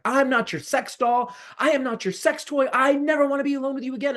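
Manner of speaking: high furious scream; screaming at her to never come near again. Retold or imitated. Teen screaming at his abuser at the door. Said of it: "I started screaming at the top of my lungs".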